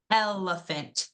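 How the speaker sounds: elephant with EL drawn out and stressed at the front; stress on first syllable. Shown as a demonstration. Teacher showing students where the stress sits, EL leaned on in elephant. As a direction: overpronounce the EL that opens elephant. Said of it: "We right away overpronounce that L in the beginning".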